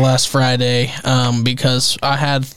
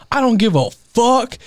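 defiant brother; 10 semitones higher than usual. Retold or imitated. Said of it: "my brother was like".

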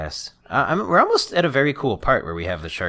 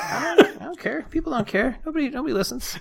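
high, whiny fake sob; mock crying. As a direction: wail it like a hurt child. Mock despair about the mic.

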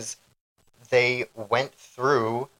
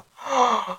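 sharp gasp; 11 semitones higher than usual. Real, not acted. Forgetting a name.

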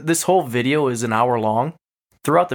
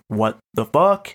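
stunned disbelief; slower than usual. Retold or imitated. Shocked hearing own recording.

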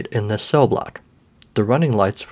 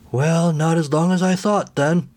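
put-on slow, frail old man voice; 7 semitones higher, slower than usual. A narrator's reading. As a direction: speak like an ancient, forgetful resident. Old man character speaks.

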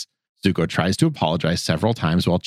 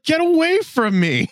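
much higher, hammed-up snappy brush-off; mock her pushing him away; annoyed Toph. Voicing Toph rebuffing Zuko.

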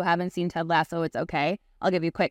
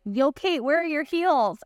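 lightly acted, higher-pitched heckle; mock calling out; 7 semitones higher, slower than usual. People asking the speaker about her trademark shoes, mock calling out. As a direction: call out to her as a curious acquaintance.

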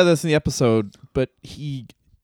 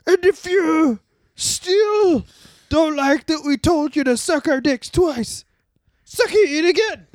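high, slow hammed-up promo; X-Pac promo voice; 12 semitones higher, slower than usual. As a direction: drag out the taunt. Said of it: "X-Pac comes in like".

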